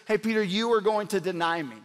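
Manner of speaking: ordinary talk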